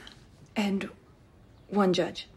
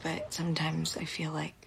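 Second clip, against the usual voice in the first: soft whisper, close to crying; tearful whisper; 5 semitones lower, 9 dB quieter than usual. Acted. Confessing to a friend she feels undeserving, tearful whisper. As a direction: whisper through tears, ashamed. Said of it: "I don't know why I'm crying".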